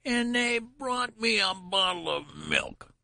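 put-on very high, slow, outraged old man squeal; old man squealing high about his bottle of milk; shrill protest. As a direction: stretch it out in shrill disgust. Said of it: "his voice rose to a shrill rise of protest".

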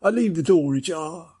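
usual speaking voice

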